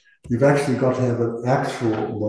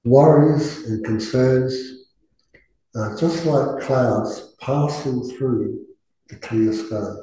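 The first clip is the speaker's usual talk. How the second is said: guided meditation. Acted. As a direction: ease the group gently into stillness.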